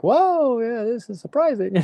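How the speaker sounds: high-pitched, amazed whoa; a big startled whoa, then trailing off; surprised whoa. Retold or imitated. We react to a rock star telling us he doesn't do drugs, surprised. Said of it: "So we were like".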